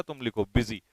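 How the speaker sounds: ordinary talk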